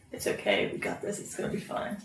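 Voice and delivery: very soft, breath-only reassurance; cool guy voice. Acted. Calming hurt Isabel by just being like, hushed cool reassurance. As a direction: soothe someone under your breath. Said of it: "Not in that cool guy voice".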